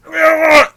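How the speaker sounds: loud high-pitched cry of effort; comic agonized heave; straining grunt. Acted. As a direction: strain noisily, voice shooting up. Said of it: "because obviously Weimar's like".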